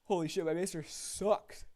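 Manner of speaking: loud, drawn-out exasperation; loud slow outburst. Real, not acted. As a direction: blurt it out, fed up. Reacting to bad maester.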